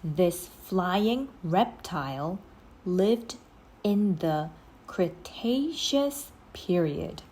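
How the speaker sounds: ordinary delivery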